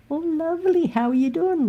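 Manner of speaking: high, syrupy imitation of her; mock sugary lady. Mocking the lady's sugary greeting.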